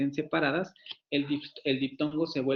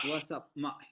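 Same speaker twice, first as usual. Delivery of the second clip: Cockney imitation; 8 dB quieter, slower than usual. Shown as a demonstration. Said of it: "glotalización".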